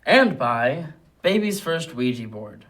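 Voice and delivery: loud, punchy ad announcement; announcer intro. Pretend. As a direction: boom out the product name. Announcing next mock ad.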